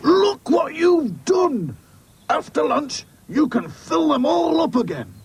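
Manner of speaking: high, slow, emphatic scolding; furious boss. Acted. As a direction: rage at the boys over the mess. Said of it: "he was furious".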